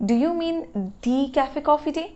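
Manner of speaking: "the" drawn out as dee; slow question stressing "the" with a long ee; emphatic "the" as dee. Shown as a demonstration. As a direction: lean on "the", rhyming it with see. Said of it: "এরকম আমি জোর দিয়ে বলছি".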